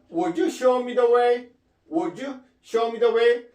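slow, careful English question; slow model question. Shown as a demonstration. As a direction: model the question slowly for learners. Teacher models question slowly. (천천히 시범 보이는 질문)

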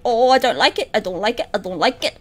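low, hushed muttering; uneasy. Real, not acted. Player nervous on night three, uneasy low mutter. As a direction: mutter it low, under your breath.

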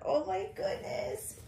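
high soft cooing; soft high coo of delight. Real, not acted. Cooing over doll.

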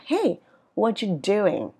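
slow "what you doing", "doing" falling; falling question tune. Shown as a demonstration. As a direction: drop the pitch through "doing". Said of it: "ngữ điệu đi xuống". ("doing" xuống giọng cuối câu)

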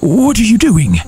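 ordinary talk

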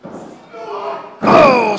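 excited shout. Real, not acted. Commentator reacts to a wrestling spot.

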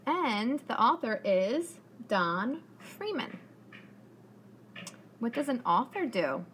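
ordinary talk

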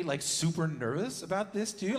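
ordinary talk

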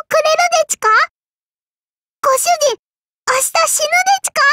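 flabbergasted, high-pitched and quickening; startled disbelief. Acted. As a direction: squeak it out in astonished disbelief. Startled at being offered a holiday.

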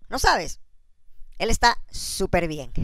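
ordinary talk

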